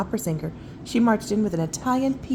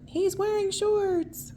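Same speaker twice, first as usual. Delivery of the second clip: high voice; 13 semitones higher, slower than usual. Acted. Amused high voiced remark.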